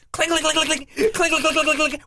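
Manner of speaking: high, laughing imitation of the hanger rattling; imitated clinking. Retelling struggling with hotel hanger, laughing clinking imitation.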